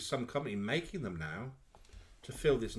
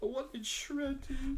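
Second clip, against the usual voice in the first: high, slow mock wail; mock crying; 12 semitones higher, slower than usual. Reprising the sketch's crying character, mock crying. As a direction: whine it out tearfully, very high. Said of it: "She's crying at the table".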